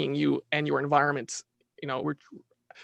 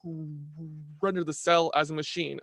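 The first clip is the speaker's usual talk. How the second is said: mock eager scientist; 4 dB louder than usual. To his podcast host, mocking reductionist scientists in a cocky voice. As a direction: parody the cocky researcher's triumphant announcement.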